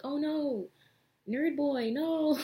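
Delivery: hammed-up high, loud cry of dismay; mock wail. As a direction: wail it like a cartoon panic. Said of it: "And they're like".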